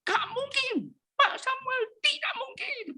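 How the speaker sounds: very high, thin cry of disbelief fading into a whisper; retold cry of disbelief. Woman crying out to the pastor at midnight, high shocked squeal. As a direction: squeal it in shock, then breathe the last words. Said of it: "Dia teriak".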